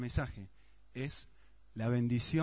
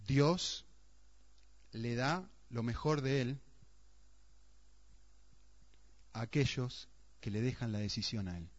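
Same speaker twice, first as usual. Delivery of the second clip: savoring quote; 2 semitones lower, 5 dB quieter than usual. Shown as a demonstration. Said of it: "Una frase que alguien dijo".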